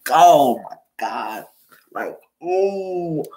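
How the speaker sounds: slow, amazed exclamation rising high; awestruck oh my god. Real, not acted. Telling viewers about ancestors, awed exclamation. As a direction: gasp it out in wonder.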